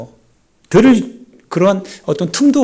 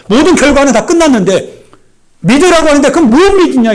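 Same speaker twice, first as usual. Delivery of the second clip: high, loud, rising sermon cry; impassioned preacher climax; preaching at full force; 9 semitones higher, 5 dB louder than usual. Acted. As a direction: press the point hard on the congregation. Preacher voices doubt fervently.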